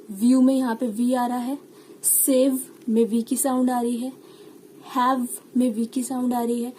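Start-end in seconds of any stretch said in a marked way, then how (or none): none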